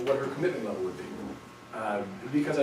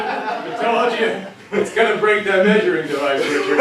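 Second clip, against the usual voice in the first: laughing; 6 semitones higher, 10 dB louder than usual. Real, not acted. Teasing after moving speech.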